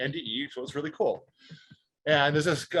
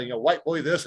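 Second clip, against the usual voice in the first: ordinary delivery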